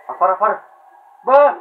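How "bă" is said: short, high, loud yell; exasperated shout. Real, not acted. Gamer ordering a fighter out, exasperated shout. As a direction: yell sharply at the opponent. Yelling at a game character.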